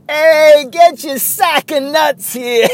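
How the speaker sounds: high, loud, stretched-out hawker holler; vendor's call. Retold or imitated. Stadium vendor hawking peanuts to the crowd, loud singsong holler. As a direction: belt it out like a Bronx peanut seller. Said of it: "But he used to go".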